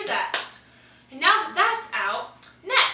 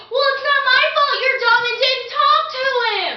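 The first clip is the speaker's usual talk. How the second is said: loud, high mock outburst; exasperated scolding; 6 semitones higher, 6 dB louder than usual. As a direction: lose patience and scold them at full volume. Exasperated adviser acted out.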